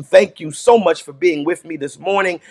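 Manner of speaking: usual speaking voice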